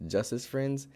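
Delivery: usual speaking voice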